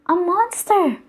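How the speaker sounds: high, loud cry of alarm; frightened shout. Acted. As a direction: yell it in panic. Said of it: "Rabbit shouted".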